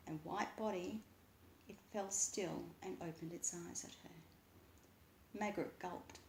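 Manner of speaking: ordinary delivery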